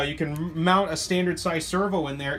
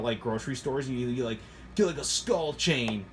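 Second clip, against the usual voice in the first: ordinary talk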